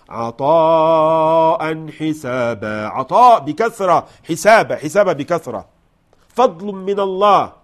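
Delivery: loud, high, rising preacher's fervour; fervent preaching. Real, not acted. Preacher exhorting listeners, loud fervent emphasis. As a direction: proclaim God's bounty with force. Preacher stresses God's bounty.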